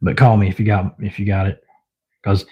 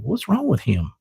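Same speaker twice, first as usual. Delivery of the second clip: softer, higher aside as another animal; puzzled onlooker pet; 6 semitones higher, 7 dB quieter than usual. Retold or imitated. Owner voicing Iris watching the commotion, puzzled. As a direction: wonder quietly, pitched up, as the watching pet. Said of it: "she's kinda just, like, sitting there going".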